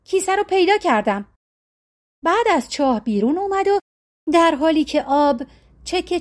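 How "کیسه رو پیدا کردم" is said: calls out high in triumph; lightly shouted, pitched up. A narrator's reading. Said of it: "فریاد زد".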